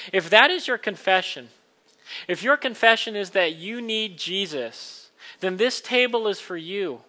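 loud, earnest preaching voice; kindly vicar sermon. Acted. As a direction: preach it warmly to the room. Communion invitation, preached loudly.

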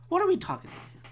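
high-pitched exasperated question; incredulous high pitch. Real, not acted. Podcaster questioning his own rant, high incredulous voice. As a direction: pitch it way up in disbelief. Same question again, exclaimed.

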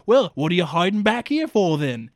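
lightly put-on rough country accent; loud, folksy challenge; Wayne's accent. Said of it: "In his accent".

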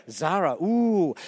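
low, drawn-out mock awe; impressed cooing. Imitating Malaysians at a Zara jacket, impressed cooing. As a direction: imitate people cooing over a label. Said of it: "in Malaysia, they go".

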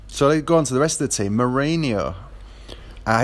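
usual speaking voice

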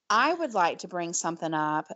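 usual speaking voice

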